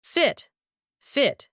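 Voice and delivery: "Fit" with a short i, pitched high; short vowel. Shown as a demonstration. Teacher giving test answer, short vowel in "Fit". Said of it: "Short vowel here".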